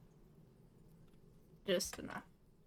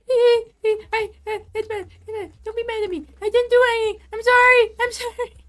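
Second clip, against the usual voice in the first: very high, loud squealed pleading; panicked apology; 11 semitones higher, 13 dB louder, faster than usual. Real, not acted. Player begging the angry creature not to be mad, panicked squeal.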